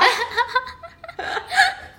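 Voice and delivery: high, loud laugh; a real laugh; burst of laughter. Real, not acted. Co-host laughing at her own joke about just being alive.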